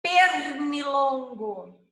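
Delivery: "Pernilongo" slow, with a long, stressed "per"; first syllable drawn out. Shown as a demonstration. Teacher gives an example starting with per, "Pernilongo" drawn out. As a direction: stretch the opening "per" of "Pernilongo". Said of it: "é com per".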